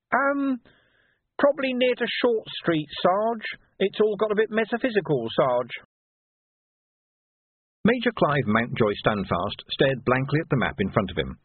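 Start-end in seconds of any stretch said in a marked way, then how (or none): 0.1-5.7 much higher, chirpy scrounger voice; Nobby boasting to his sergeant; cheeky urchin Nobby. Acted. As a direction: rattle on like a pleased little rogue. Nobby answers.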